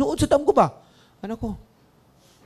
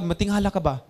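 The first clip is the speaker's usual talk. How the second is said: ordinary delivery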